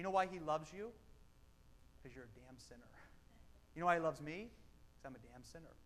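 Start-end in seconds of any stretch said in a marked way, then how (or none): 2.0-2.9 dropped low and soft, almost under the breath; hushed punchline. Real, not acted. Pastor telling the congregation why God loves them, hushed. 5.0-5.8 dropped low and soft, almost under the breath; hushed punchline. Real, not acted. Pastor telling the congregation why God loves them, hushed.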